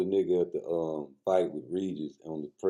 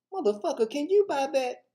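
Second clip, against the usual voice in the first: much higher, squeaky mocking pimp voice; mock high movie pimp. Comedian mocking a pimp movie quote, high mock voice. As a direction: ham up the movie pimp line.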